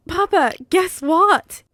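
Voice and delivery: put-on high, slow, loud child voice bursting with news; a small boy who can't wait to tell; excited little boy. A narrator's reading. Little son runs to his father, excited child voice. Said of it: "he exclaims".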